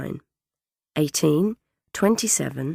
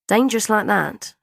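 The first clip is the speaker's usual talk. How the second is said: gran's sniffy warning, higher and louder; acted grandmotherly disapproval; 6 semitones higher, 6 dB louder than usual. A narrator's reading. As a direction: sniff disapprovingly like a fussing gran. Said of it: "Gran had sniffed".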